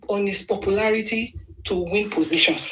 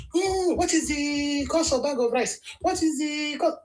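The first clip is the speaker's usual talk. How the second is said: scoffing, higher mock voice of a know-nothing; mocking ignorant arguer; 7 semitones higher than usual. Mimicking ignorant people who dismiss Tinubu, scoffing. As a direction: sneer it like a stubborn arguer asking about rice. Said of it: "they will tell you".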